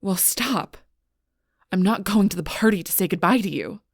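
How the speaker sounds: loud, irritated refusal; angry outburst. Acted. Refusing to go say goodbye at the party, loud and angry. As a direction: raise your voice to shut them down. Snapping at someone bothering them.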